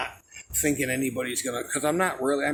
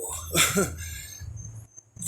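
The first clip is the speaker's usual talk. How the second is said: laugh; 3 semitones higher, 4 dB quieter than usual. Real, not acted. Laughing at the jump.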